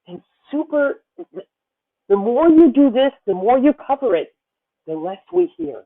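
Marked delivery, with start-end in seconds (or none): none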